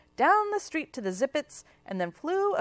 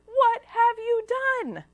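much higher and louder, furious; thundering shout; 12 semitones higher, 7 dB louder than usual. A narrator's reading. Aunt Bort furious at Watilda's web hairdo, thundering shout. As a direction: roar her name across the salon.

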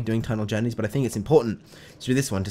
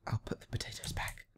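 whispering; 14 dB quieter than usual. Real, not acted. Caught taking dad's potatoes.

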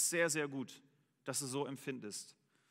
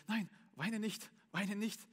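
tender comforting; 6 semitones higher, slower than usual. Retold or imitated. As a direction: soothe your crying brother gently. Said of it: "Und er so zu mir plötzlich".

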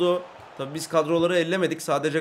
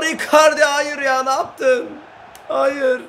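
1 dismayed cry; 7 semitones higher, 6 dB louder, slower than usual. Real, not acted. Kaçan pozisyona tepki.

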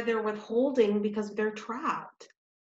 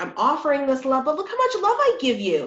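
mock self-righteous giver; 7 semitones higher, 7 dB louder than usual. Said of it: "It's like".

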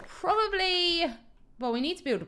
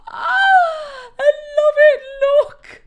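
high, loud squeal of delight over her build; 14 semitones higher, 11 dB louder, much slower than usual. Real, not acted. Gamer squealing at her finished tree, high and loud. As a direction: shriek with joy at the tree.

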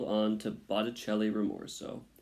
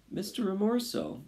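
high, timid little plea; high-pitched anxious mouse; small mouse voice; 11 semitones higher than usual. A narrator's reading. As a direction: plead upward as a tiny mouse. Frightened mouse pleads with rat.

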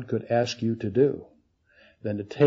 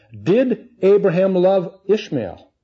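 ordinary talk, nothing marked

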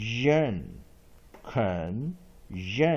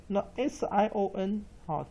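ordinary delivery

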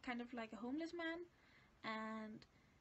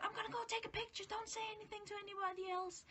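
hurried instruction; 8 semitones higher, faster than usual. Retold or imitated. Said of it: "And then I said".

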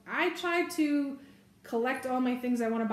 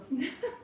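short, higher-pitched chuckle; real laugh; 6 semitones higher, 3 dB quieter than usual. Real, not acted. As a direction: let out a brief real laugh. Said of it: "I'm laughing because".

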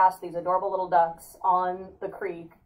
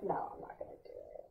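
whispered refusal; 18 dB quieter than usual. Retold or imitated. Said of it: "I was like".

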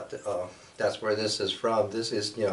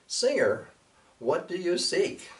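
a high, light voice for the hummingbird; 9 semitones higher than usual. Acted. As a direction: lift the voice high for the little bird. Hummingbird speaks to the singer.

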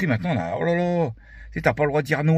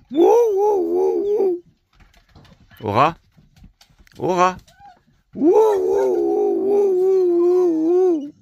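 imitated dog bark; 4 dB louder, much slower than usual. Barking at the dog.

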